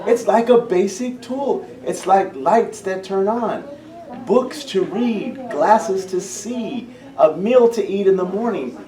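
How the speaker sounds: high, slow, earnest insistence; eager leaders all saying yes; voicing the leaders. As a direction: speak up for them, lifting each item. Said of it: "They all said".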